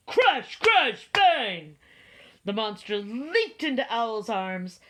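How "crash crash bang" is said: loud, sharply falling sound words; thunder crashes. A narrator's reading. Narrator voicing thunder over the friends, loud crashes. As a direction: hit each crash hard.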